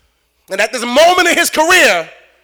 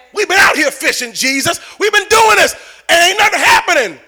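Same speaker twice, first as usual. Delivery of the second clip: gruff fisherman climbing into a higher, louder shout; rough Peter; 7 semitones higher, 4 dB louder than usual. Acted. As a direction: push back hard at Jesus. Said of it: "Peter was rough".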